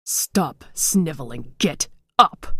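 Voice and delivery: slow, then a loud barked order; stern command. A narrator's reading. Father's ghost ordering his daughter up, stern shout. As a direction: scold her to her feet.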